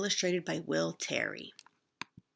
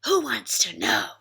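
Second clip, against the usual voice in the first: loud snarl of a bully goat; Rough snarling at Little Ogre; 8 dB louder than usual. A narrator's reading. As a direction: snarl it back at him. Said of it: "snarled Rough".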